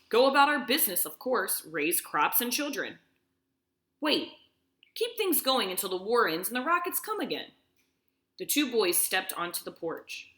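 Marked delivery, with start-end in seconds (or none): 0.1-7.5 a father answering firmly to keep the family calm; lightly voiced steady father. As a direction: sound in control for the children.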